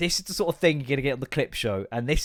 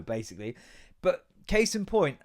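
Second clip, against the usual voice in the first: ordinary talk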